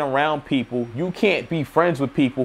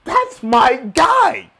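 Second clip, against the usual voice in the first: approving; 9 semitones higher, much slower than usual. Retold or imitated. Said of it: "I was like".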